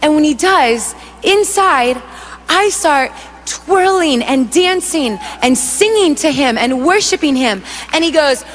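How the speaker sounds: ordinary delivery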